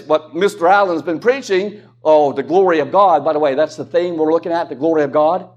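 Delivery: ordinary talk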